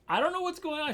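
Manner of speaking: high, panicky voice of a scared tripper; panicked plea. Retold or imitated. Tripping on acid, calling girlfriend for help, panicked and high-pitched.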